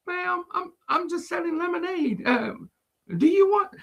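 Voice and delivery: put-on meek kid just selling lemonade; lightly voiced timid girl; little girl voice. Little girl answers the lady.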